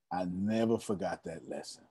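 soft, low, "never" said gently with no push; hushed first take before the emphatic repeat; quiet reflective line. Shown as a demonstration. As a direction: murmur it to yourself, lightly. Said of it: "Never, ever, ever".

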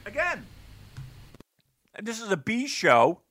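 talking around a chewable vitamin; mouth full. Real, not acted. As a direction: speak while chewing a tablet. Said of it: "Sorry, I'm eating a, a vitamin".

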